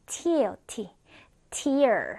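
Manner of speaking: "tear" said like "tier", with an ee sound; noun pronunciation. Shown as a demonstration. Teacher shows students the noun, "tear" pronounced like "tier". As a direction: say "tear" to rhyme with "ear". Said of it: "명사일 때는".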